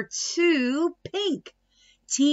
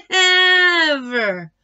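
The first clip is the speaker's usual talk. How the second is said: laughing never; 5 semitones higher, 4 dB louder than usual. A narrator's reading. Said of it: "said Tina".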